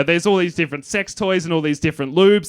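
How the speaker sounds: usual speaking voice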